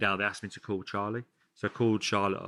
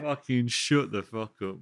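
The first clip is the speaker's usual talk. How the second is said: laughing mock retort; 2 semitones higher, 5 dB louder, slower than usual.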